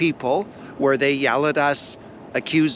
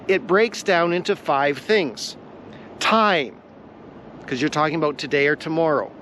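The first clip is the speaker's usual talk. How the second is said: ordinary talk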